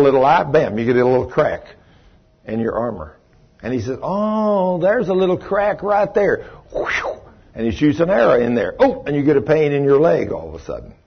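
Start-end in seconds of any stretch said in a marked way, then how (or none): 4.0-6.5 high, sly devil voice, pitched way up; devil spotting a crack. Acted. Preacher voicing the devil as he finds a weak spot, high and sly. 6.7-7.2 whoosh. Acted. Arrow sound effect.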